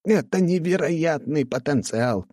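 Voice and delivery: high, drawn-out rapture; delighted. Acted. Artificer thrilled by the horns' potential, high delight. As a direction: marvel slowly, pitched well up. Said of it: "подпрыгнул от восторга".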